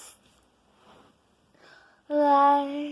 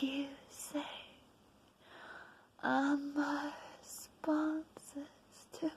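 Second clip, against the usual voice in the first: whisper; 13 dB quieter, faster than usual. Acted. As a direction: drop to a secret whisper. Whispered tags in poem.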